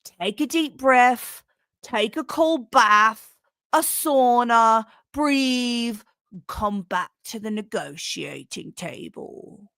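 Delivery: put-on Alexander Stubb in a British accent. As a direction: read the Finnish president's quote in a put-on British accent. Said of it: "so I made, I made him British".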